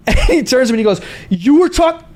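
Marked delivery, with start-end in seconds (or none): 1.3-2.0 high indignant outburst, cut off; retold outraged start, broken off; angry confrontation. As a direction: imitate a furious man rounding on someone. Angry man turns, then recognizes him.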